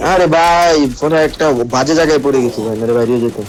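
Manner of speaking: loud exasperated groan of a gamer; frustrated shout. Real, not acted. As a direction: vent it loudly, annoyed. Stuck in bad spot, frustrated.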